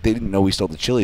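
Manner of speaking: normal talking voice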